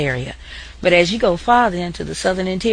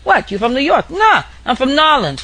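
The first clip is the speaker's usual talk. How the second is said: put-on higher and louder New York–style New Orleans accent; 7 semitones higher, 5 dB louder than usual. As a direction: trade quips like a New Yorker from New Orleans. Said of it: "more like New Yorkers".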